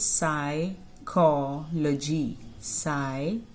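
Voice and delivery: "psychology" split by syllable, starting on S; silent P in psychology. Shown as a demonstration. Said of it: "the letter P is silent". ("psychology" को धीरे "साइ-को-लॉजी" बोलें)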